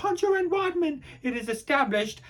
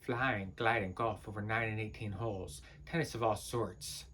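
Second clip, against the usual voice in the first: low, very soft monotone ramble; subdued muttering; 8 semitones lower, 15 dB quieter than usual. Acted. Rambling on for reasons unknown, muttered low. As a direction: let the rant sink into a mumble. Acted monologue sinking to a mutter.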